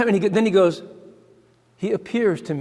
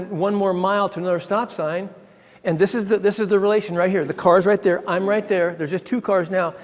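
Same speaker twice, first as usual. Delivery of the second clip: usual speaking voice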